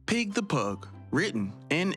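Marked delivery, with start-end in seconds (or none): none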